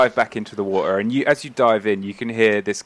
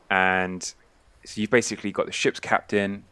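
ordinary delivery

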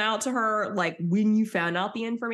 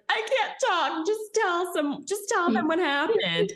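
mock sobbing; 12 semitones higher than usual. Stassi voiced sobbing, retold.

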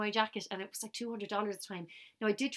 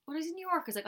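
ordinary talk

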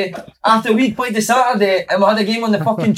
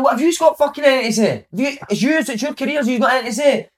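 high-pitched retold rant at a teammate; acting out a furious outburst, pitched way up; going mental; 7 semitones higher than usual. Footballer rounding on a teammate after a defeat, going mental. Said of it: "I'm talking mental".

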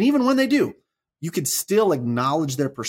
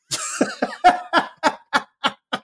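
breathy, high laugh; real laugh at the twist; burst of laughter. Real, not acted. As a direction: caught off guard into laughing. Laughs at son's punchline.

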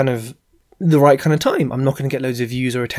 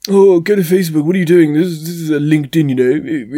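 mock indignant scolding; 3 semitones higher, 6 dB louder than usual. LinkedIn police scolding a poster, mock indignant and loud. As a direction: play the snooty LinkedIn police telling you off.

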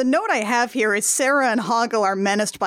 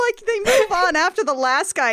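normal talking voice

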